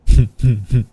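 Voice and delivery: sudden loud burst of laughter. Real, not acted. Streamer laughs at the joke.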